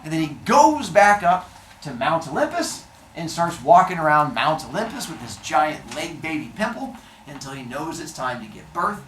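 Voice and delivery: ordinary delivery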